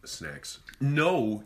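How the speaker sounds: normal talking voice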